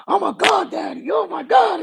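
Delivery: 1 imitating excited shout. Retold excited quote of Vince.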